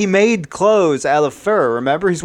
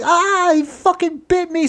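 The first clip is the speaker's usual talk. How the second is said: very high-pitched cry of pain; pained yelp. Acted. Dan bitten in the thigh, yelping in pain.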